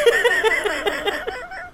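high peal of laughter; amused laughter. Real, not acted. Laughs at bad impression.

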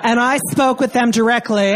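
ordinary talk

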